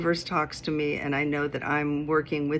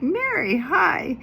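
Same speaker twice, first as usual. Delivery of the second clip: much higher, squealing hello; delighted greeting; 13 semitones higher, 5 dB louder, slower than usual. Retold or imitated. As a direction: greet an old friend with glee. Friend greets her delightedly.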